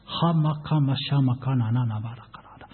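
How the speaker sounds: soft, quick flow of tongues; speaking in tongues. Shown as a demonstration. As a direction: let syllables pour out softly, unplanned. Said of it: "私の中から新しい言葉が出てきたんです". (静かに流れ出る異言)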